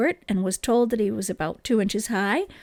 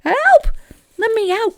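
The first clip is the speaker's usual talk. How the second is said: much higher and louder, a terrified cry; frightened cry for help; 12 semitones higher, 8 dB louder than usual. A narrator's reading. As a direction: call out for help in panic, trapped and hurt. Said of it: "cried Stuart, who was frightened".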